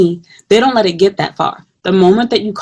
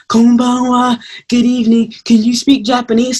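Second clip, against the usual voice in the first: lightly voiced persistent stranger, slightly raised; imitated pestering stranger; 3 semitones higher than usual. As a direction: imitate a man pestering a passerby. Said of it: "that guy that was following me ... kept saying".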